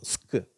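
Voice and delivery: ஸ்கு as a hissed s running into a short k; S then K. Shown as a demonstration. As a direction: blend s into k on ஸ்கு. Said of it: "எஸ் சொல்லிட்டு அப்புறம் க்கு சொல்லணும்". (ஸ்கு - 'ஸ்' சொல்லி பிறகு 'க்')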